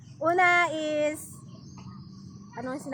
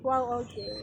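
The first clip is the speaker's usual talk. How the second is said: low, soft let-down; disappointed; 5 semitones lower, 6 dB quieter than usual. Shown as a demonstration. First try at the disappointed impression, disappointed. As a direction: drop the voice in disappointment.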